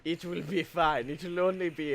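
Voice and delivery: put-on calm a fussy child; soothing. Calming tired co-host.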